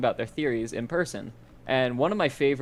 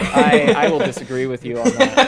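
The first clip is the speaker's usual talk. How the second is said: louder, laughing through the words; 7 dB louder than usual. Real, not acted. Disagreeing with a friend's objection mid-story, laughing. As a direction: push back while laughing.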